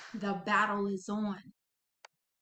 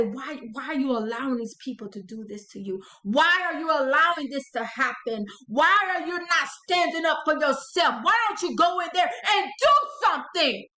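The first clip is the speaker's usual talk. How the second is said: taunting; 3 semitones higher, 6 dB louder than usual. Acted. Dark adversary goads you to fight back, taunting and building to a shout. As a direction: needle them harder with each question until shouting. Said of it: "starting to really taunt you".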